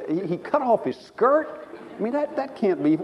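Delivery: can't believe what he just read; disbelief. Retold or imitated. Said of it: "I said".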